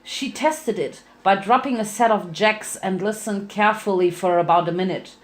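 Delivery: plain reading voice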